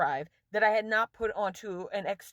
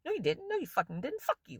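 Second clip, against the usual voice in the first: usual speaking voice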